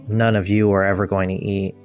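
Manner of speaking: ordinary talk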